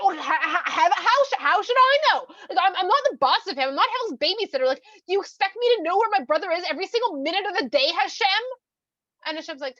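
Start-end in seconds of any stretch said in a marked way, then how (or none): 0.0-8.3 higher, louder, stammering and fast; defensive panicked denial. Acted. Cain denying to Hashem he knows where Hevel is, flustered and loud. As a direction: bluster your way out of being caught.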